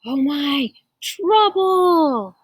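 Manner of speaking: high, loud cry of alarm; alarmed exclamation. Acted. Reader warning as the wolf arrives, alarmed. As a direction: build to a drawn-out, high exclamation. Granny's trouble announced dramatically.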